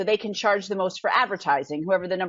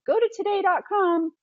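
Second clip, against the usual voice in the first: higher, bright show-host sign-off; mimicking TV host; 9 semitones higher than usual. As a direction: sell it like a morning host. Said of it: "they always say".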